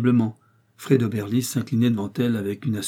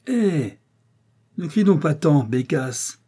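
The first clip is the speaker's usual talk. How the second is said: high, louder scolding cry; exclaimed; 6 semitones higher, 4 dB louder, slower than usual. Acted. Father scolding his shouting daughter, loud exclamation. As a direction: snap at her loudly. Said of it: "clama monsieur Richard".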